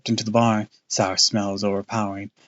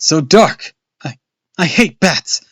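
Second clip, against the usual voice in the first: high, loud frightened stammer; stammering fright; 7 semitones higher, 11 dB louder, slower than usual. A narrator's reading. Boy stammers in panic among bats. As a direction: stumble over the words in panic. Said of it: "I stammered".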